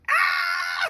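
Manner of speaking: loud, very high yell; sharp yell. Real, not acted. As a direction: let out a sudden high yell. Sudden yell between jokes.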